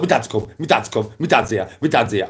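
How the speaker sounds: high, loud, gushing dog voice; excited dog voice. Retold or imitated. Voicing the dog greeting his owner, high loud excited yelp. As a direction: squeal it like an overjoyed dog. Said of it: "He was like".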